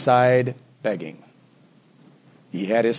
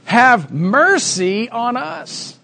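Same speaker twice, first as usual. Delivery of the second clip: slow, higher-pitched plea; crying out; 7 semitones higher, much slower than usual. Retold or imitated. Two blind men beg Jesus alongside the road, crying out. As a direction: draw out the cry for mercy. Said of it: "Who cries out for mercy?".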